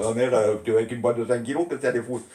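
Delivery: ordinary delivery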